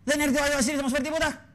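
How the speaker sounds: high, whining plea to the bishop; pleading poor folk. Retold or imitated. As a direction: beg for a handout in a high whine. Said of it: "Κάντε και για μας φτωχούς". (ψηλή, κλαψιάρικη παράκληση φτωχών)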